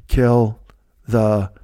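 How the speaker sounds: each word spaced out slowly while typing; slow dictation. Retold or imitated. Drunk Dean typing the joke into his phone, slow and spaced. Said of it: "as he's typing it in the phone".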